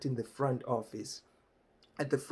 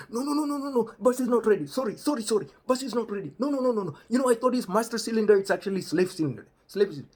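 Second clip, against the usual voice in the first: much higher, louder, fast flustered excuses; flustered Rajiv; 9 semitones higher, 7 dB louder, faster than usual. Retold or imitated. Rajiv flusters at the driver, high and loud. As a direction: babble excuses in a rush. Rajiv panics, bus not ready.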